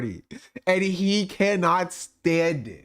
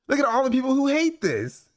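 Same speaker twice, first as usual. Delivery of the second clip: mocking impression; 7 semitones higher than usual. Mocking him again.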